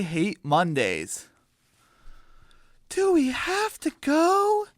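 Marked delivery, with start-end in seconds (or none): 2.9-4.7 put-on very high, childlike chick voice; high little chicken pleading; whiny chick voice. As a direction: whine it like a kid chicken. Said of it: "says Poppy Seed".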